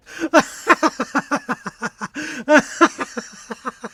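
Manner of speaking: high, long teasing laugh; Henry's mocking laugh. A narrator's reading. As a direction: laugh at him openly.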